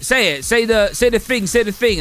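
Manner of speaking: high, loud, excited begging; pestering demand. Retold or imitated. Chat viewers pester the streamer to say it, excited mock begging. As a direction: imitate an eager crowd egging someone on.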